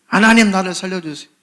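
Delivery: loud, pleading cry to God; crying out in prayer. Acted. A believer crying out loud to God, pleading. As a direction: beg God loudly for rescue. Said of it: "하나님을 찾는 거예요".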